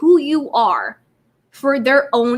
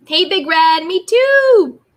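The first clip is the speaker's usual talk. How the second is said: excited greeting; 6 semitones higher, 5 dB louder, slower than usual. Real, not acted. Greeting a chat member excitedly.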